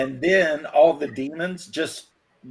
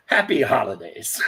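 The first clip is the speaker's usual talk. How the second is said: laughing through it; 4 semitones lower than usual. Real, not acted. Delivering punchline while laughing.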